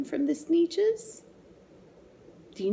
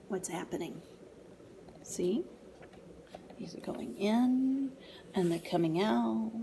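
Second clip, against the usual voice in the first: hushed storytime whisper; 8 dB quieter than usual. Real, not acted. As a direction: lean in and whisper. Whispering to child over book.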